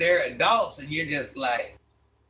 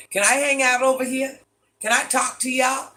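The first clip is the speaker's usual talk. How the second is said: higher, a bit louder, eager and hopeful; mock eager newcomer; 7 semitones higher, 3 dB louder than usual. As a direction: play the shy kid asking to join the cool kids. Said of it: "if you came on and said".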